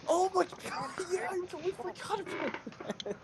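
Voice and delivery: high gasping "oh yeah" with a laugh; sudden recall. Real, not acted. Friend interrupted, suddenly recalling the broken-down car, surprised. Suddenly remembering forgotten event.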